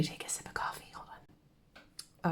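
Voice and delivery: quick hushed whisper. Real, not acted. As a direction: drop to a fast whisper. Aside while sipping coffee.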